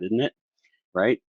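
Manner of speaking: normal talking voice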